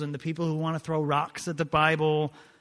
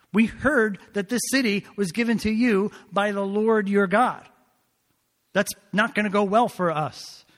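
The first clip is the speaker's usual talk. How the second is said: higher, louder self-justifying protest; defensive pleading; 4 semitones higher, 6 dB louder than usual. Acted. Gibeonites justifying their deception to Joshua, defensive and exasperated. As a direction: plead your case as if it were obvious. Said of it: "How did they answer Joshua?".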